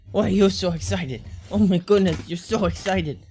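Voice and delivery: cooing at a bouncy puppy; excited dog talk. Real, not acted.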